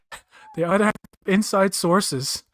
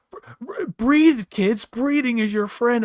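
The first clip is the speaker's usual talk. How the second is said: mocking the doctor; 6 semitones higher, slower than usual. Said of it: "he's like".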